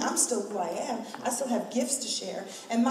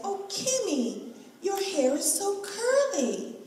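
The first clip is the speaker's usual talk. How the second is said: high, slow, sweetly surprised mother; mother's voice; 10 semitones higher, slower than usual. Retold or imitated. Mother texting back about her curly hair, high and slow. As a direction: coo it like a delighted mom.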